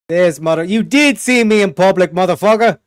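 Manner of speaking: loud indignant yell; outraged shout. Real, not acted. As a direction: yell it in disbelief. Outraged at clip.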